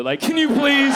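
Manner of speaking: mock desperate plea; pleading shout. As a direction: beg her loudly.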